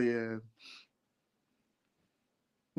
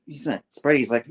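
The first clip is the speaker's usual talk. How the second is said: ordinary talk, nothing marked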